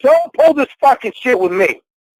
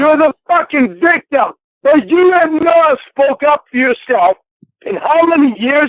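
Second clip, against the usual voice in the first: angry yelling; 6 semitones higher than usual. Real, not acted. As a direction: drag out each word in anger. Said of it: "Then yell at me".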